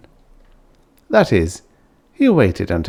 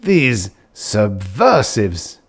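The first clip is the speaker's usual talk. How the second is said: word found with effort; 2 semitones higher, much slower than usual. Acted. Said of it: "he found another word".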